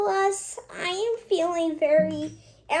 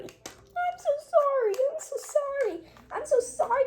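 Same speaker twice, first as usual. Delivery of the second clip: high, pleading apology; small high voice begging sorry; 10 semitones higher than usual. Acted. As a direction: beg forgiveness in a high voice. Child voicing a sorry character.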